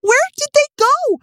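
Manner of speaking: high, loud, bewildered question; incredulous high cry; baffled disbelief. Real, not acted. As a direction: ask it still stunned. Disbelief after the reveal.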